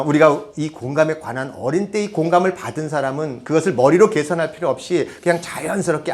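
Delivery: ordinary talk, nothing marked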